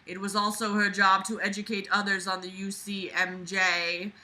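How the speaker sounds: usual speaking voice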